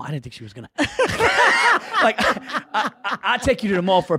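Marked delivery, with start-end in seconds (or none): 0.7-4.2 high, fast laughing retelling; cracking up while telling it. Real, not acted. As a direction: laugh through the words.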